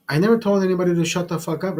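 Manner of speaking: ordinary talk, nothing marked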